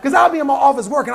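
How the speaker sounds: ordinary delivery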